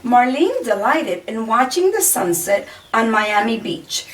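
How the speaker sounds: plain reading voice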